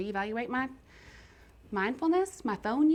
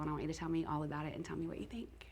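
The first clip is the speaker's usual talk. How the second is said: hushed, soft, almost breathless delivery; secret whisper; 3 semitones lower, 10 dB quieter, faster than usual. Real, not acted. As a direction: whisper it softly, like letting her in on a surprise. Whispering a surprise gift offer.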